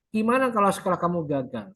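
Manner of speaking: ordinary delivery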